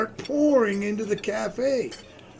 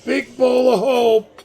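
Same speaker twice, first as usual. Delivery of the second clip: loud call back from the kitchen; calling out order; 4 semitones higher, 9 dB louder than usual. Acted. Cook echoes the order loudly.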